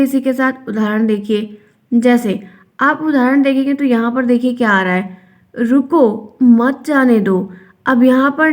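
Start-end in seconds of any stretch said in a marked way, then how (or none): 5.5-7.5 "रुको", a clear break, then "मत जाने दो" drawn out slowly; pause after रुको. Shown as a demonstration. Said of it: "ये अल्प विराम है". (रुको के बाद विराम)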